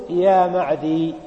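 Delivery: "يا معدي" said slowly, stopping short at "معدي"; slow demo of "يا معدي" for students; clipped vocative demo. Shown as a demonstration. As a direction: drag out "يا معدي" as a model answer. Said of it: "فتقول".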